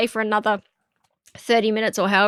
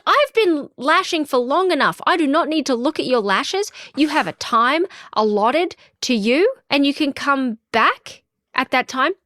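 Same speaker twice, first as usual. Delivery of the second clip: higher-pitched, emphatic retold telling-off; exasperated firmness; 5 semitones higher than usual. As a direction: act out laying down the law to a pushy client. Retold quarrel with pushy client.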